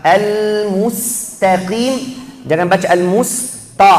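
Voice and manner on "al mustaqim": slow Al-mustaqim with thin ta and heavy qaf; correct recitation. Shown as a demonstration. Teacher modelling the right way, slow. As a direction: draw out Al-mustaqim carefully.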